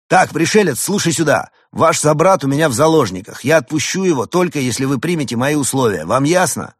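loud, hard ultimatum; threatening. A narrator's reading. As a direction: make the threat firmly. Bur makes threats. (угрожающе)

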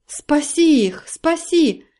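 high anxious plea; elves crying out. A narrator's reading. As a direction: cry out for help. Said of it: "закричали эльфы".

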